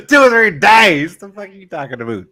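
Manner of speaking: incredulous Canadian-accented outburst, loud and high at first; Canadian accent, dropping away on the last question. Real, not acted. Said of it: "I use my Canadian accent".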